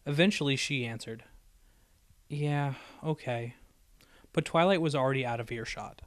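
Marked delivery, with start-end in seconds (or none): 2.3-3.5 slow, soft and downcast; dejected. A narrator's reading. Dash answering after long silence, slow soft dejected. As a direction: mumble it, defeated. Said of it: "looked down at the ground".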